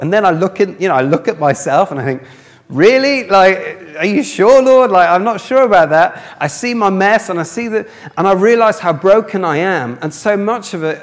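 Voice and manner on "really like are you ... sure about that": high, loud, incredulous questioning; doubting disbelief. Retold or imitated. Preacher doubting aloud to the Lord, incredulous. Acts out doubting God's valuation.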